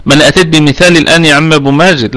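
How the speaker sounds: usual speaking voice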